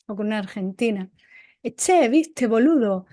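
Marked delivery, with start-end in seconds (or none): 1.6-3.1 Argentine accent. Shown as a demonstration. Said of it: "si estoy hablando con un argentino".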